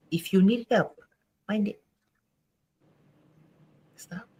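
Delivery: soft stern warning, trailing to whispers; quiet firm warning. Real, not acted. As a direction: quietly warn abusers to stop. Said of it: "it took a lot to say that".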